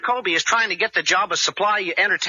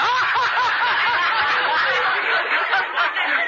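laughter; 10 semitones higher than usual. Real, not acted. Audience laughter after joke.

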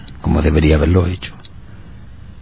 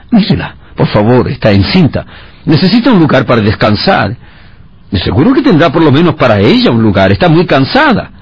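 pleading Joseph; 6 semitones higher than usual. A narrator's reading. Joseph pleading for his wife.

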